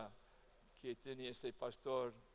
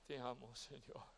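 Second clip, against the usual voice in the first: heartfelt prayer; 3 semitones lower, 6 dB quieter than usual. Shown as a demonstration. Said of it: "Tiene que brotar profundamente del alma".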